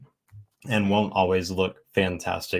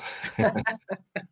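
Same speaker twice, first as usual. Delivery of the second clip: bright burst of laughter, higher; 14 semitones higher, 4 dB quieter than usual. Real, not acted. Co-host amused by Susan's pitch, high laugh.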